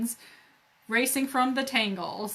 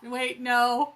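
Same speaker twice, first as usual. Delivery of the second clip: ordinary delivery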